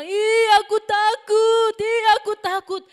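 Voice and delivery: very high, loud, slow frightened squeal; scared disciples. Acted. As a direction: act the terrified disciples for children. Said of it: "ketika murid-muridnya bilang".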